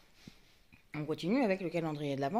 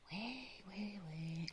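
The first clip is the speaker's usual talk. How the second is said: whisper; 11 dB quieter, slower than usual. Real, not acted. Whispered to herself.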